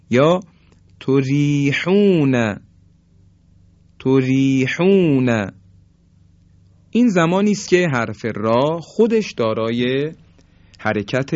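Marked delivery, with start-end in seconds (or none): 1.0-5.5 تریحون slowly, with a thin R and long vowels; light R. Shown as a demonstration. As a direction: recite تریحون drawn out, R light. (تریحون کشیده با «ر» نازک)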